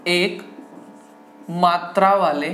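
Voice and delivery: broken up with long pauses; word-by-word dictation. Shown as a demonstration. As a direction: dictate slowly so students can write. Teacher dictates heading word by word.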